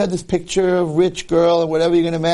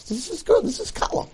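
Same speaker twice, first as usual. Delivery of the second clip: mock father; 13 semitones higher, 5 dB quieter than usual. Mocking father handing over bride.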